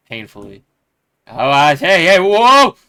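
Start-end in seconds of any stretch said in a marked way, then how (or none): 1.3-2.8 building, louder and higher yelp; startled yelp. Acted. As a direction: yelp as the hand is crushed. Hand squeezed painfully.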